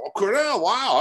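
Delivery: excited role-play reaction; mock impressed. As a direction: act wowed by the news. Agent gushes at seller's college news.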